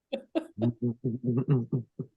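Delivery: a low, quiet laugh; real, quiet chuckle; soft laugh. Real, not acted. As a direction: chuckle under your breath.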